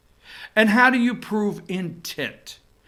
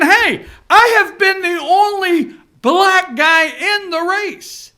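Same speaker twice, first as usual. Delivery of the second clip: mock candidate voice; 9 semitones higher, 5 dB louder, slower than usual. Mocking a candidate.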